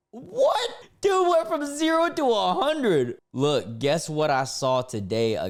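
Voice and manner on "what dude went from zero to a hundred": much higher, louder disbelieving yelp; shocked outburst. Real, not acted. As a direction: blurt it out in total shock. Shocked by escalating rant.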